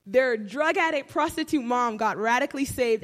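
usual speaking voice

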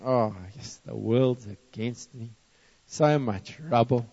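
soft, slow self-pitying sigh of a voice; mock demotivated moaning. Workers at the wall moaning to themselves, mock defeated. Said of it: "They're thinking".

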